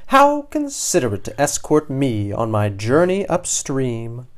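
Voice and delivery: gushing, swooping fish voice; cheery gratitude. A narrator's reading. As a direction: thank the heron effusively. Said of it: "cheers the unfortunate Doreen".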